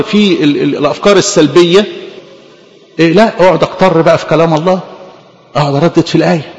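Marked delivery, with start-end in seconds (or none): none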